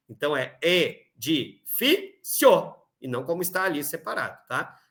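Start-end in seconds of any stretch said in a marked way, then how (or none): 0.6-2.7 "edifício" stretched out slowly, syllable by syllable. Shown as a demonstration. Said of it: "não deve ser separado". ("edifício" pronunciado devagar, sílaba por sílaba)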